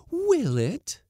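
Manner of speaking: high, loud question rising at the end; loud challenge. A narrator's reading. Cook doubting the skipper, high rising challenge. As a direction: throw it back high and loud, lifting at the end. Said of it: "demanded Missus Blossom loudly".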